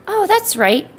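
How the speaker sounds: high, loud, quick excited recall. Real, not acted. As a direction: burst out remembering. Sudden recollection.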